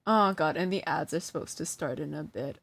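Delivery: ordinary talk, nothing marked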